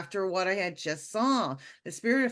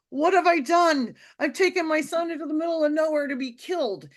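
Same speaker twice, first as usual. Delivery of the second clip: loud, high panicked cry; what have I done, shouted in fear; 6 semitones higher, 4 dB louder than usual. Retold or imitated. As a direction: cry out in sudden terror. Panicked thought about her son.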